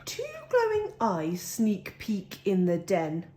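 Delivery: ordinary delivery, nothing marked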